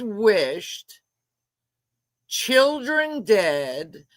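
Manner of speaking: slowly dragging out the words; appalled, every word stretched; slow outrage. Real, not acted. Streamer repeating the wish, slowly and in disbelief.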